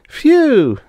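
high, loud phew at a bad smell; disgusted phew. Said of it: "said Bonnie".

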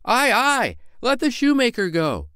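high, loud calling out; crowd agreeing. Acted. Townsfolk calling to send the shoemaker, loud. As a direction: cheer him on. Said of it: "cried some".

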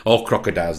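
normal talking voice

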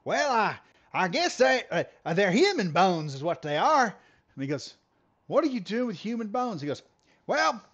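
a hint of the twangy old driver; old man explaining the bones to the cop, lightly twangy; old country boy. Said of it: "is what he sounded like".

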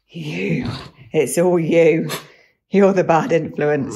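loud, slow mock telling-off; playful scolding. Real, not acted. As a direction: blame the dog, loud and slow. Playfully blaming the dog.

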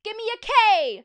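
loud, high cheerleading chant; high-pitched pep-rally yell; cheerleader shout. A narrator's reading. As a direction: belt it out to rally the crowd. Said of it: "shouted the cheerleaders".

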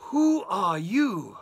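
mother dragon. Acted. Mother dragon confronting the wolf, slow and loud. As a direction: loom over him with the question.

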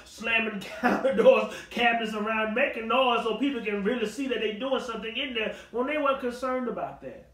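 ordinary delivery

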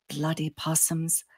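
hushed grumble under the breath; whispered. A narrator's reading. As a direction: say it softly, barely a whisper. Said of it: "I whispered".

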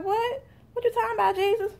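high, indignant squeal of disbelief; a hammed-up shocked girl in church; mock shock. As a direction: act out being offended by the news. Shocked reply to Jesus.

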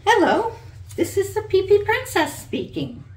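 high, loud, bright fairy-tale princess answering a phone; Pee Pee Princess voice. A narrator's reading. The princess picks up Sally's pretend call, high and loud.

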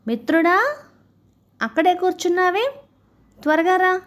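high-pitched call up to a friend. Acted. Crocodile to the monkey sitting up the tree, calling out. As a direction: call him down, voice lifted high.

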